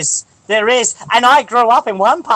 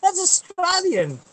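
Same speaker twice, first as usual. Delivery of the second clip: high, slow Aussie drawl; hammed-up Australian accent; 9 semitones higher, 4 dB quieter, slower than usual. Defending his Aussie act, high drawn-out Australian. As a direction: stretch it out in broad high Australian.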